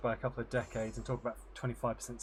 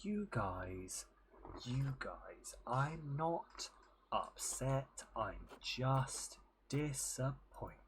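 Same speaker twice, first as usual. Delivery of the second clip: slow, soft, patronising teacher voice; condescending head teacher; 5 dB quieter, much slower than usual. Retold or imitated. Streamer mocks the head teacher to chat, slow and condescending. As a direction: sigh the words out like a let-down teacher. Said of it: "in a real condescending way".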